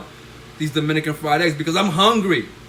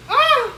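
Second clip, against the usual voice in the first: sudden high yelp at the spitting oil; startled yelp; 6 dB louder than usual. Real, not acted. Cook yelps as hot oil spits. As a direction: jump back with a sharp cry.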